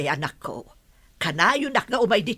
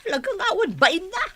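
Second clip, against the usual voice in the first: laughing with contempt, higher; mock her shamelessness through a laugh; scornful laugh; 6 semitones higher than usual. Mother scoffing at her son's wife, scornful laugh.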